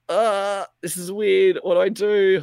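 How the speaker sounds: high, loud unsure rush; nervous. Retold or imitated. Gamer hiding in crow's nest, high nervous. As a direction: panic a little, voice up high. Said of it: "And I was like".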